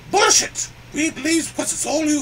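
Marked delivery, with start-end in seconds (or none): 0.1-0.7 sudden higher, louder angry cry; angry outburst. Acted. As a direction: blurt it out in fury. Elephant outburst at humans.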